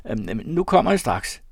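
stammer, then call out in confusion; flustered. A narrator's reading. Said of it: "Og forvirret som jeg var".

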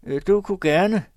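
high, loud hopeful request; tentative wheedling. A narrator's reading. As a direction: angle for a favour you don't expect. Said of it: "men dog i en tone, som viste". (prøvende, bedende tone)

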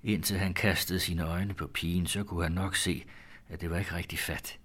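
usual speaking voice

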